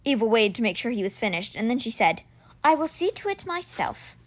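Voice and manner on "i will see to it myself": a hint of a higher, softer voice for Eva; a touch of a different voice for Eva; lightly voiced Eva. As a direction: give Eva a light, lifted tone. Eva's reply, voiced differently.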